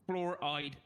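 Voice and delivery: "Floor-eyed" as two distinct parts; floor then eyed. Shown as a demonstration. Coached word by word, careful "Floor-eyed". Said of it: "say floor and then say I'd".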